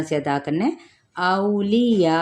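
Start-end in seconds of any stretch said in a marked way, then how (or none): 1.2-2.2 औलिया said very slowly, औ glided and या held; slow recitation; slow repeat. Shown as a demonstration. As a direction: draw औलिया out for the learner. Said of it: "दोबारा पढ़ दिया है".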